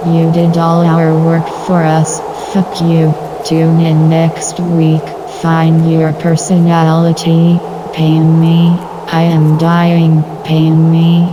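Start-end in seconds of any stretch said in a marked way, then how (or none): none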